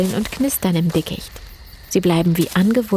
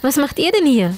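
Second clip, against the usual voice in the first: high, lifted questioning tone; curious; 7 semitones higher than usual. A narrator's reading. Anne comes upon the children at night, asking curiously. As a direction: ask with lively curiosity. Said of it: "fragt Anne neugierig".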